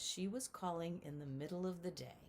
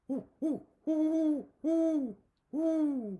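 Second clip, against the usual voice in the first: loud, high hooting owl call; booming owl hoots, higher and louder; great horned owl hoot; 8 semitones higher, 10 dB louder, slower than usual. Shown as a demonstration. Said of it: "and he sounds like this".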